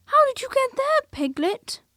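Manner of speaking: put-on a boy asking in plain surprise; Christopher Robin's ordinary voice. A narrator's reading. Christopher Robin finding Piglet, high surprised boyish voice. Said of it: "in his ordinary voice".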